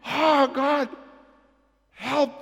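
high, loud plea to God; retold cry for help, pitched high. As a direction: cry out desperately to heaven. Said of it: "We cried out to the Lord for help".

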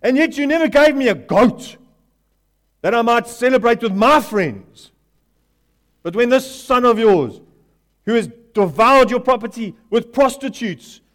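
loud, high, indignant protest; furious son shouting at his father; angry older brother. Acted. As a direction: let the resentment pour out. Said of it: "he answered his father".

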